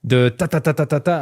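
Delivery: ordinary talk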